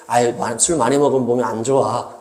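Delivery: watered-down warning, soft and easygoing; soft, mild advice. Shown as a demonstration. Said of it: "이렇게 바꿨다는 거죠".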